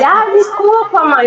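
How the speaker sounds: a hint of a sorry kid; apologetic child. Retelling son's apology to mother.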